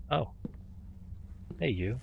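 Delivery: ordinary delivery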